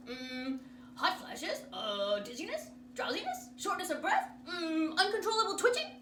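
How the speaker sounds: put-on loud, fast-listing old doctor; fussy doctor. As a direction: rattle off the checklist. Doctor voice rattling symptoms.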